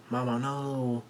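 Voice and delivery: drawn-out, understated cry of protest; mild mock yell. A narrator's reading. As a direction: stretch the plea out without really shouting. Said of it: "Dogtooth yells out".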